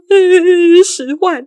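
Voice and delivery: high, loud wailing hesitation, then the answer; mock sobbing. Crying caller naming the sum to mum, mock sobbing. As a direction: imitate a crying caller, hammed-up. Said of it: "里面男子又哭得很伤心".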